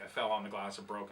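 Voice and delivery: ordinary delivery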